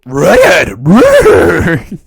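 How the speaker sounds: loud Scooby-style shout, lightly voiced; imitating Scooby. Quoting Scooby's loud lines, a loud mock Scooby shout. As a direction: bark it out like Scooby. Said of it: "Scooby's licks are so loud".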